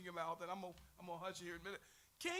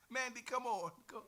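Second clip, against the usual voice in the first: normal talking voice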